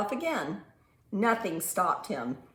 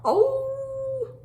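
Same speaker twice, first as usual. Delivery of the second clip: high, loud dog howl; 6 dB louder than usual. A narrator's reading. Storyteller howling as lonely Clifford, high howl. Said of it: "he began to howl".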